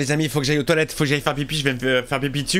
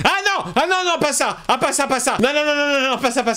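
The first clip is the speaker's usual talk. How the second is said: panicked; 11 semitones higher than usual. Real, not acted. Dreaded scene on screen.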